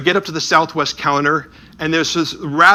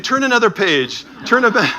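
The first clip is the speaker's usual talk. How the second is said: crowd calling out; 8 semitones higher than usual. Retold or imitated.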